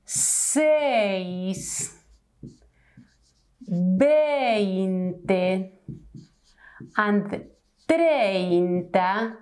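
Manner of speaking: seis, veinte and treinta with long pauses; slow careful examples. Shown as a demonstration. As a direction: dwell on each word. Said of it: "So remember, these three words".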